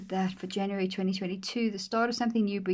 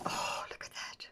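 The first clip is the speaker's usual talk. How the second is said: whisper; 10 dB quieter than usual. Real, not acted. Whispered wonder at page.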